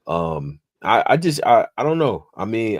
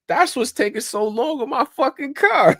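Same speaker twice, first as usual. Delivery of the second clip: much higher, squeaky mock voice of an annoyed car owner; mock YouTube viewer; 4 dB louder than usual. Imitating a YouTube commenter, squeaky complaining voice. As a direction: pitch it way up, a fed-up customer griping. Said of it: "somebody on YouTube ... be like".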